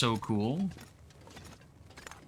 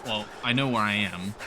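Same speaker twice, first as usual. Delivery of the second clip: ordinary talk, nothing marked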